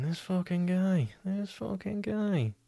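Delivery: high, drawn-out exasperated aside; mock disbelief. Thinking about the lad insulting him, drawn-out incredulity. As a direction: stretch it out slowly and high in disbelief.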